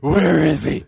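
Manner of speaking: rough Batman-like rasp; Batman growl. Acted. Comic leans into his Batman voice, interrogating growl. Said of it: "I still sound like Batman".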